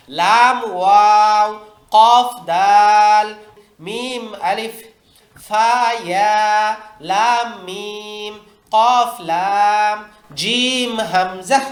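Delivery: put-on very slow, drawn-out letter names for children; slow drill reading. Shown as a demonstration. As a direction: spell each pair out slowly for the class to copy. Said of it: "উস্তাদ এভাবে বাচ্চাদের practice করাই দিবেন". (ধীরে টেনে টেনে হরফ পড়া)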